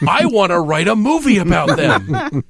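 comic alien enthusiasm; eager alien. Acted. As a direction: announce it like a keen alien filmmaker.

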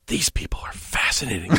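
low, hushed alien whisper; mock-mysterious whispered alien voice. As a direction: whisper in awe like a fascinated alien. Voices an alien studying humanity.

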